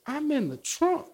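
high, slow put-on voice; movie quote voice. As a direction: act out the film line. Quoting a line from the movie.

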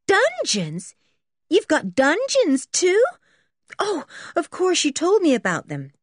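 high-pitched, thrilled astonishment; amazed. A narrator's reading. Berta learning of the dungeons, amazed. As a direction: squeal it in amazed delight.

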